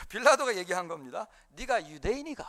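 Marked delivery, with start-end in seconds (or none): 1.5-2.4 comic Gyeongsang-dialect question. Retold or imitated. Preacher replays Pilate's question for the congregation, in playful Gyeongsang dialect. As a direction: put on Gyeongsang intonation for a joke. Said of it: "경상도 버전으로 한번 이걸".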